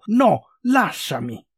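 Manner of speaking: drawn-out, forceful refusal; resisting cry. A narrator's reading. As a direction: cry out as you fight off a grip. Girl rebels against being pulled.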